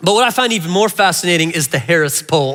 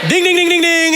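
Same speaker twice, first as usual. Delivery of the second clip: game show ding; 8 semitones higher than usual. Pretend. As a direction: ring it out like a Family Feud buzzer. Game show correct answer bell.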